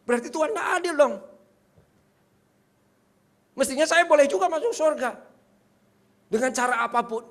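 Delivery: high, loud complaint; indignant protest. Retold or imitated. Preacher voices B protesting God is unfair, indignant. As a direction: argue the injustice, pushing pitch and volume up. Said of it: "kalau B-nya protes gimana".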